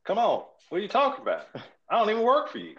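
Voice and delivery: hammed-up Southern twang, shouting at a would-be boss; country accent. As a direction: go full tilt with a hammed-up country voice. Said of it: "Was that country enough?".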